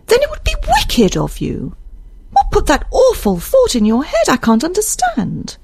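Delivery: very high, appalled character voice; shocked, wailing disapproval; reproachful Isabel. Acted. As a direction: reproach him in high-pitched distress. Reader voices horrified Isabel.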